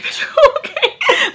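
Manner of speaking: very high, drawn-out squeal with laughter; mock freak-out. Reacting to friend coming to, high laughing freak-out. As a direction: squeal it slowly, breaking into a laugh.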